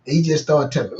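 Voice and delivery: normal talking voice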